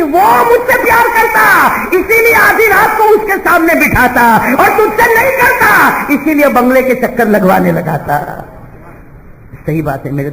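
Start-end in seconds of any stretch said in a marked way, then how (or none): 0.0-8.4 fervent retort. Retold or imitated. Slave girl answers the master.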